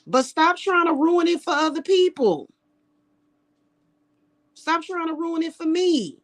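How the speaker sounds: high, heated scolding of the haters. Real, not acted. Speaking to her haters, telling them off in a high heated voice.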